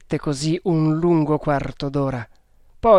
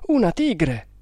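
higher-pitched alarmed cry; startled, pitch jumping up then falling; frightened exclamation; 5 semitones higher than usual. A narrator's reading. Newcomer spotting the tiger, alarmed and frightened. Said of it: "esclamò quello, spaventato".

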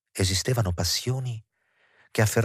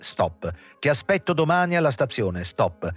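imperious telegram; 5 semitones higher than usual. A narrator's reading. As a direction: read out a commanding telegram. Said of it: "il seguente telegramma".

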